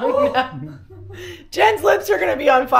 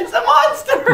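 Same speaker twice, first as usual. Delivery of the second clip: mock scared; 12 semitones higher, 6 dB louder than usual. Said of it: "And she said".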